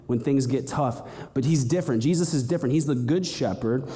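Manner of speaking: normal talking voice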